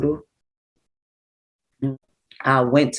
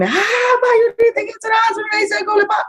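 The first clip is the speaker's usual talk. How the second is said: frantic scream. Retold or imitated. Stephanie screaming down the phone about the hospital, frantic. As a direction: relay Stephanie's screamed phone news in a rush. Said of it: "She's screaming".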